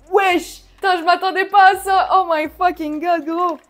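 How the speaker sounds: loud, high shriek of real surprise; shocked outburst. Real, not acted. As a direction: blurt it out, stunned by how big it is. Shocked at huge product size.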